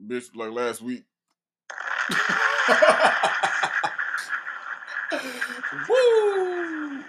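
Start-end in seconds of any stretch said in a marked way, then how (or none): 1.7-5.8 loud, high burst of laughter; helpless loud laughing fit; big laugh. Real, not acted. Bursts out laughing after her joke. 5.9-7.0 high, loud drawn-out "woo"; long high holler of amusement; laughing whoop. Real, not acted. Whoops while recovering from laughter.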